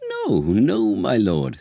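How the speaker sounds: loud, pleading denial; urgent protest. A narrator's reading. Adviser protesting loudly to the king. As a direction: cut in hard to protest. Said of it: "said Camillo".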